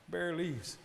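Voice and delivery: usual speaking voice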